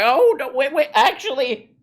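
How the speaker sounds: high, flustered backpedal; mock Peterson. Imitating Peterson dodging the move to Tampa, high and flustered. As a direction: squeak out a panicked retreat. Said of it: "He's like".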